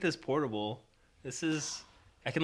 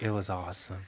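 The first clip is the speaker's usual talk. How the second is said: imitating friend; 3 semitones lower, 5 dB quieter, faster than usual. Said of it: "it's always like".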